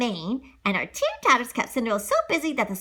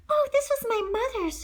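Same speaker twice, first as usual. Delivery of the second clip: sweet Cinderella; 14 semitones higher than usual. Acted. Cinderella to animal friends.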